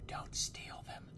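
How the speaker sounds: slow, hushed aside; whispered warning. Real, not acted. Gamer cautioning viewers about the weapons, slow whisper.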